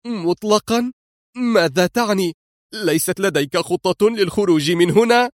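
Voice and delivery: "مطلقا؟" flung back high and rising in alarm. Acted. Woman panics at her rescuer, high and alarmed. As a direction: panic creeping in, disbelieving. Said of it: "هاتفة في ذعر وارتياع".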